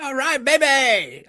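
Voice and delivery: put-on high, slow, drawn-out "all right, baby"; drawn-out hyped "all right, baby"; slow hype call. Shown as a demonstration. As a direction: stretch "baby" like a hype man. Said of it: "You gotta cheer".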